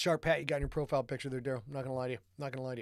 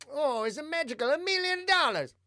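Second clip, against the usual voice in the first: high, loud mock Italian accent; put-on Italian accent; 12 semitones higher, 5 dB louder than usual. Host joking about how he will sound, exaggerated Italian accent. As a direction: ham up an Italian accent, pitched way up. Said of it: "when you have to do that accent".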